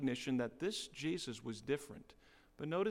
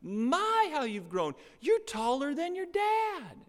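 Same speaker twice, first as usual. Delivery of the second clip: much higher, louder gushing aunt voice; gushing relatives; 9 semitones higher, 9 dB louder, slower than usual. Retold or imitated. Relatives to the grown boy at a reunion, high gushing coo. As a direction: coo over the nephew while pinching his cheek. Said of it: "do the little whole cheek thing".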